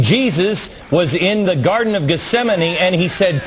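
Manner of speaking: ordinary delivery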